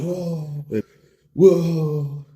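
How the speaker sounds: high, drawn-out howl; mock pained wail. Whiskey burns the wound, bro wailing in mock pain.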